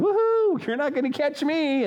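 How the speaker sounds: cheeky dog teasing its master; playful dog voice. Pretend. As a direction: voice the dog gleefully dodging its owner. Dog playing keep-away.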